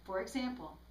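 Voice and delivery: ordinary delivery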